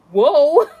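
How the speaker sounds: loud, high startled whoa. Retold or imitated. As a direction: recoil with a big whoa. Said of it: "I was like".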